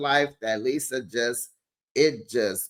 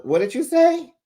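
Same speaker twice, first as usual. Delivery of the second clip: very high, louder incredulous question; shocked demand; 14 semitones higher, 5 dB louder than usual. Retold or imitated. Castmate confronting another over gossip, shocked demand. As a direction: shoot the pitch right up in disbelief.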